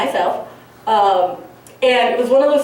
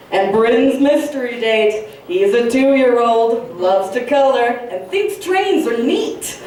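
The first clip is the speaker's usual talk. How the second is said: teasing sister; much slower than usual. Retold or imitated. Sister announces the toddler date from the other room, slow and teasing. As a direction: imitate a sister calling out a teasing introduction. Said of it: "my sister from the other room go".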